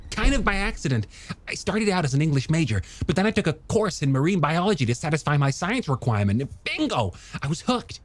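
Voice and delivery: high, quick, animated character voice; enthusiastic young scientist. Acted. Scientist telling her how he got hooked, high eager voice. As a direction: tell it eagerly, pitched well up.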